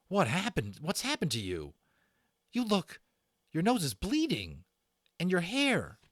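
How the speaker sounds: put-on high, urgent frightened boy; Patrick's worried voice. A narrator's reading. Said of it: "Patrick voiced, frightened friend".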